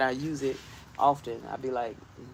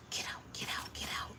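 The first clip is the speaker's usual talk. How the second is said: hushed, urgent whispered get out; whisper-shoo the spider away; shooing the spider in a frightened whisper; 14 dB quieter than usual. Real, not acted. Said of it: "see me act a fool".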